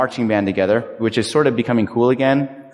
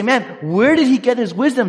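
impressed; 9 semitones higher than usual. Retold or imitated. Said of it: "they are impressed".

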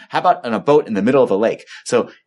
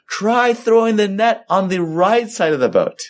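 a higher, slow call across the water: "Try throwing the net"; calling from shore; 8 semitones higher than usual. Acted. Jesus calling to the disciples from the shore, slow and raised. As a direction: call out slowly from far off.

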